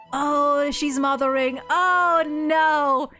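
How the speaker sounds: ordinary delivery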